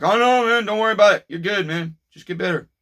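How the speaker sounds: high, loud, easygoing reassurance at the start; breezy friendly neighbor voice, high at the start; imitating neighbor. Neighbor waving off the delay, breezy and high. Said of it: "He's like".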